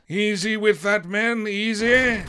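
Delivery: steady the men handling powder; captain's command. A narrator's reading. Captain warns the crew with the powder, slow command.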